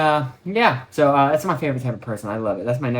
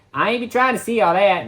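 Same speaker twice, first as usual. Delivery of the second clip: the line again, higher and sassier; sassy lady impression; 8 semitones higher, 4 dB louder than usual. Uber driver brushing something off, sassy and higher. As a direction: push "I ain't be trying to see all that" up with attitude. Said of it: "That's what she said".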